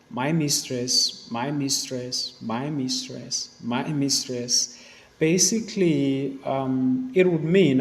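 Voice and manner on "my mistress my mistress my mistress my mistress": "my mistress" said slowly four times, each one weighted; repeated for emphasis. Shown as a demonstration. Teacher to students, showing the repeated phrase with slow, deliberate emphasis. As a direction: lean on each "my mistress" to show the repetition. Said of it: "So what is being emphasized here?".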